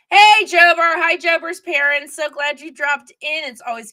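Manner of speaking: loud, high hello to a friend; real delighted shout of hello; excited greeting. Real, not acted. Greeting a viewer who dropped in.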